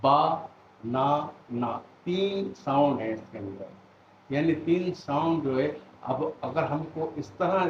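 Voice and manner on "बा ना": very slow, louder: बा, ना as separate beats; teacher sounding out बा ना; syllable by syllable. Shown as a demonstration. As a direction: split बा ना into slow chopped syllables. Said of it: "फ़र्ज़ करें बनाना".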